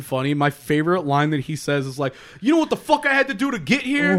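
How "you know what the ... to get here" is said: mock angry quote. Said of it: "And he's so mad".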